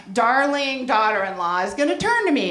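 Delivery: normal talking voice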